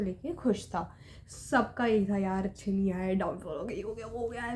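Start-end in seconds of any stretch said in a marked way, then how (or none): 2.2-4.5 a gloomy classmate moaning about a bad result; mopey classmates. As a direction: lower and softer, deflated grumbling.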